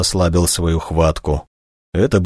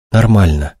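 ordinary delivery, nothing marked

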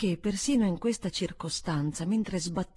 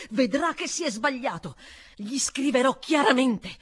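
higher and louder, firm and inexorable; no room for argument; relentless tone; 7 semitones higher, 8 dB louder than usual. A narrator's reading. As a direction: governess vowing to write, relentless. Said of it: "proseguì su un tono inesorabile".

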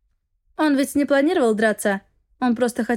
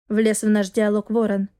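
ordinary delivery

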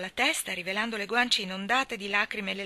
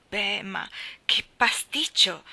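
louder, slow exasperated cry; exasperated outburst; 6 dB louder, slower than usual. A narrator's reading. Marilla exclaiming to Matthew over the mix-up, exasperated. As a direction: throw up your hands at the mess. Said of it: "sbottò ancora Marilla".

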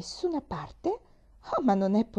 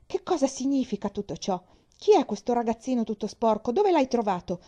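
sharp, louder demands, higher; curt; 5 semitones higher, 5 dB louder than usual. Acted. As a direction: clip each question at the girl. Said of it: "chiese seccamente". (secca, brusca, più forte)

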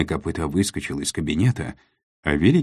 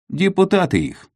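excited; 6 dB louder than usual. A narrator's reading. Kopyto answers.

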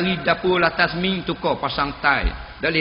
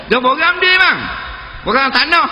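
high, loud fibbing husband; hammed-up husband fibbing; sheepish husband; 9 semitones higher, 4 dB louder than usual. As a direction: cover the lie with bluster. Husband lies to wife.

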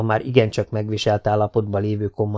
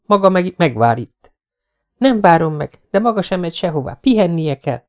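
higher, louder, pressing; insistent young woman; 7 semitones higher, 4 dB louder than usual. A narrator's reading. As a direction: refuse to let him go. Jessica insists. (határozott, erélyes női hang)

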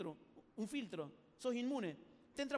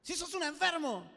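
higher, louder, slow mock insult; insulto exclamado; 6 semitones higher, 5 dB louder than usual. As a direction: throw the insult out, drawn out. Acts the insulter escalating. (insulto gritado y alargado)

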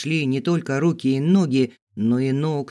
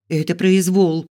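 raised, louder protest; indignant; 5 semitones higher, 5 dB louder than usual. A narrator's reading. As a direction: object indignantly, voice up. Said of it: "возмутилась я". (возмущённо, повысив голос)

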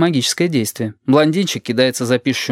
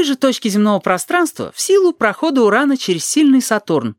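high-pitched pedantic woman on a roll; acted schoolmistress, pitched way up; carried-away lecturer; 11 semitones higher than usual. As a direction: reel off the deduction in a high voice. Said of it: "А Терезу несёт".

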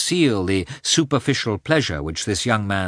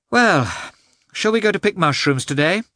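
much higher-pitched, lively character voice; high-voiced, sociable suggestion; lively question; 9 semitones higher, 3 dB louder than usual. A narrator's reading. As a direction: pitch it up high, louder on "Well". Character's bright question in narrated dialogue.

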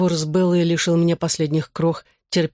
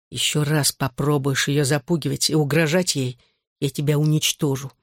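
ordinary delivery

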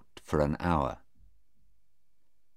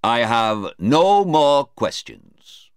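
slow, louder declaration; emphatic close; 7 semitones higher, 7 dB louder, slower than usual. Acted. Police lawyer finishing his questioning, emphatic. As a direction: round it off slowly and firmly.

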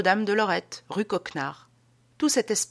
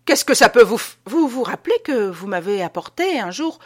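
irritated retort; 4 semitones higher, 7 dB louder than usual. Acted.

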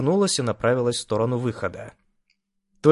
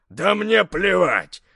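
growling bugbear; 7 semitones higher, much slower than usual. Acted. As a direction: bark it down at her. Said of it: "рыкнул на неё". (рык здоровяка)

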